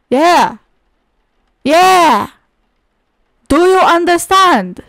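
loud, high, hectoring demand; berating shout. Pretend. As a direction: drive it home at full voice. Berating someone in the game.

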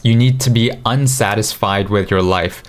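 ordinary talk, nothing marked

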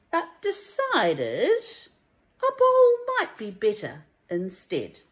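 lively, sing-song rhyming storybook read; reading to children. A narrator's reading. Teacher reading a rhyming picture book to children, lively and sing-song. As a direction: bring each rhyme to life for little listeners.

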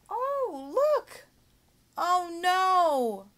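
high, loud hammed-up dismay for a child; shocked teacher. Shown as a demonstration. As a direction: gasp out exaggerated alarm at the story. Demo reacting to story.

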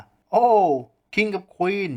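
high-pitched crow of triumph; gleeful shout. A narrator's reading. Mr. D tallies his winning cards, gleeful shout. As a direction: burst out high, delighted at winning. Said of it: "ตะโกนว่า".

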